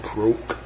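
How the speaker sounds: low, soft little croak; toad croak. A narrator's reading. Toad answering Blue, low soft croak. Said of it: "Toad said".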